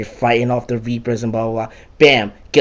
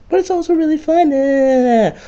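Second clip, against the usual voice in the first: squealed concession. Real, not acted. Gamer admitting the hated missions are fun, squealing. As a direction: squeak it out, trailing into a long eh. Sudden switch after rant.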